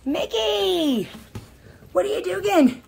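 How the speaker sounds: high, loud puppy-scolding call; high-pitched calling out. Real, not acted. Owner catching the puppy at something, high playful call. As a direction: call out high and falling to the dog.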